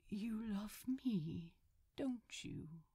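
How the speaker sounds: slow breathy whisper; hushed tender question; soft whisper. Acted. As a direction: lean close and ask tenderly. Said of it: "he asked softly".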